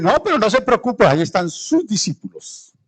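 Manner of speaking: lifted, chirpy voice of a bystander; high mock reassurance. Bystander telling the father not to worry, chirpy high voice.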